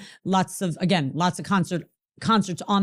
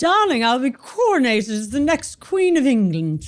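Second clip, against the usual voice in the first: British accent; 5 semitones higher than usual. Shown as a demonstration. Guest answering the host's request in a British accent. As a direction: say it like an English royal in a British accent. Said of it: "now do it with a British accent".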